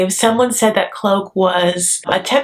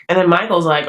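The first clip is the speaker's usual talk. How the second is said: ordinary talk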